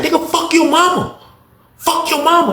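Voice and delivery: very high-pitched imitation of a woman cursing; mock angry insult. Officer insulting the inmate back, high mock anger.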